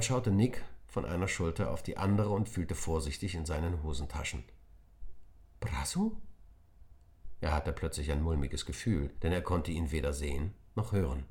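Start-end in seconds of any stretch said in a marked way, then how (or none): 5.6-6.2 worried call. Acted. Nick calls his lost friend.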